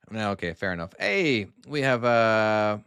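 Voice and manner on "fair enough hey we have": ordinary talk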